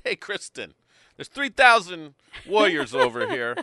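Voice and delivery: put-on Italian accent. Inviting a co-host on a mad mission, mock Italian accent. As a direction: ham up an Italian accent, high and loud. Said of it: "talking in an Italian accent".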